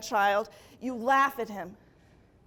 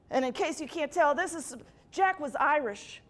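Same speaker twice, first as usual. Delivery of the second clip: normal talking voice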